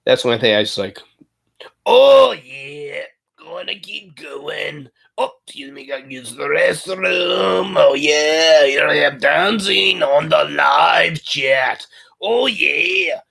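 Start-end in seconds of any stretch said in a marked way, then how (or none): 1.9-13.2 high, slow, drawn-out character voice; hammed-up movie impression, stretched and high; Fat Bastard. Shown as a demonstration. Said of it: "I'm just gonna talk like this".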